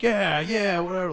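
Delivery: high, loud, dismissive yeah yeah; offhand. Retold or imitated. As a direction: brush it off casually.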